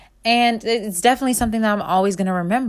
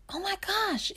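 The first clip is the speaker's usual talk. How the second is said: stunned gasp; 12 semitones higher, 10 dB quieter than usual. Retold or imitated. Learning the writer died.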